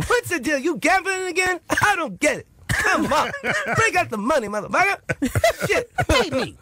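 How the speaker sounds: high-pitched, squawking stand-up rant; Chris Rock. Acted. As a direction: demand it in a high, punchy impression. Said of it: "You gotta do Chris Rock on him".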